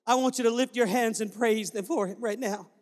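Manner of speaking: ordinary talk, nothing marked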